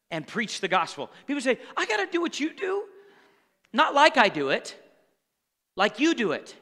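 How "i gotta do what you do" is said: higher-pitched put-on objector; mock objection. Preacher imitating people who say it, mock objection. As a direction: lift the voice into a protesting parishioner.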